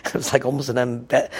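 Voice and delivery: usual speaking voice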